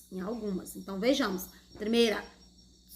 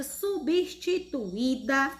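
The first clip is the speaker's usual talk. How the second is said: drawn-out emphasis; much slower than usual. Shown as a demonstration. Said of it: "teacher stretches key word".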